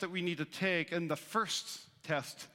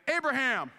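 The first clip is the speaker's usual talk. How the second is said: God calls Abraham's name, raised and loud; calling out; 6 semitones higher, 5 dB louder than usual. Acted. God calling Abraham, louder call. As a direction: summon him by name. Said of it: "he said to him".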